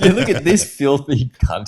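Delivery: hammed-up posh English accent, laughing through it; mock English accent. Mocking the friend on the pipe in a bad English accent. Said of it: "listen to that horrible accent".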